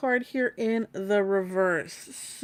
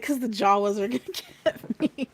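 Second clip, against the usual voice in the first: laughing. Real, not acted. Quoting friend, laughing.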